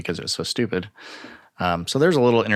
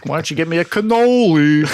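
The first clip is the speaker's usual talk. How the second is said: higher, loud mobster demand; Italian American voice; 7 semitones higher, 6 dB louder than usual. Pretend. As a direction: bark the order like a mobster. Mock Italian husband demand.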